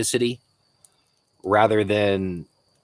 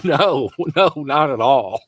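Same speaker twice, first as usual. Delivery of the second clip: emphatic denial through laughter, higher; laughing; 4 semitones higher, 4 dB louder, slower than usual. Real, not acted. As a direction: laugh it off, a touch louder. Laughs off question about governor.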